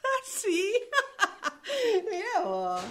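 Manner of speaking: high, mocking laugh; scornful laughter. Author told her characters are unhappy, laughing scornfully. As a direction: laugh it off in disbelief.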